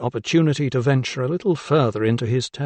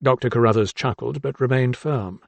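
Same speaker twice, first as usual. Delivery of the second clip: usual speaking voice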